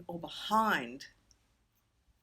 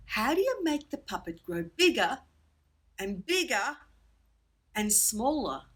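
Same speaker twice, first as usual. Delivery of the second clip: usual speaking voice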